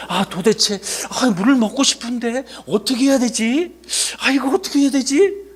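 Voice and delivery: acted thirsty complaint. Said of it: "갈증 나기 시작을 했어". (목말라 애타는 불평)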